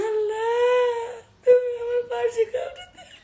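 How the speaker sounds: wailed, tearful plea; crying prayer. Retold or imitated. Pleading with Allah for someone beside them, crying. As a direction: call out to God while really weeping. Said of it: "কাঁদছি".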